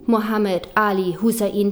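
ordinary talk, nothing marked

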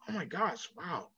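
breathless, awed little gasp of a wow; floored by the fans, barely voiced; hushed amazement. Real, not acted. Vlogger awed by UK followers, hushed and amazed.

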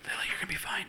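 unvoiced hushed whisper to a toddler; sneaky babysitter whispering to Lily, then leaving. Fake babysitter to child.

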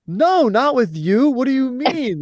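high, slow incredulous reply; no way, what do you mean, pitched high in disbelief; mock disbelief. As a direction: play it like he can't believe the question. Retelling own disbelieving reply.

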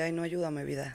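hungover groan. Retold or imitated. Hungover woman mock-pleading to friends, faint whiny moan. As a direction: plead weakly like a wrecked hangover. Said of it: "la recuperación de".

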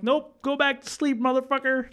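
high-pitched mock dismissal; dismissive refusal. Sleeper sending crying friend back to sleep, dismissive. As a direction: brush off the crier in a squeaky-high voice.

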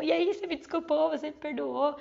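eager asking. Acted. Eagerly asking sister for forgiveness.

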